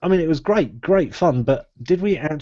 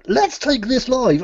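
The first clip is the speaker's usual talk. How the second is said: high, slow mock voice; mocking quote; 11 semitones higher, slower than usual. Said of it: "but then you said".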